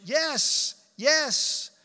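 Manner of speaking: high, loud, eager cry; desperate yelling. Acted. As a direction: answer like someone dangling for dear life. Dangling man answers heaven's voice.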